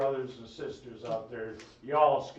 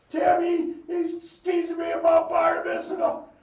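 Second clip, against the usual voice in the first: put-on much higher, louder whiny kid voice; tattling little brother; 10 dB louder than usual. [name] telling on Timmy to mom, whiny high shout.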